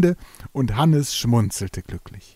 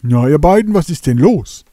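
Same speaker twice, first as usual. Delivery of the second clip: hearty question; 4 semitones higher, 9 dB louder than usual. Acted.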